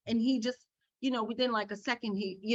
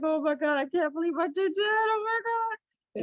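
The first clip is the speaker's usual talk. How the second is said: embarrassed; 9 semitones higher than usual. Pretend. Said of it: "I would be so embarrassed".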